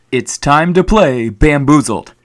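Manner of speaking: slow, drawn-out game show announcer; game show host voice. Pretend. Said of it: "Just kidding".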